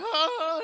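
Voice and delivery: loud, high-pitched acted cry; short hammed-up scream; mock fainting scream. Fay Wray is grabbed and faints, high mock scream.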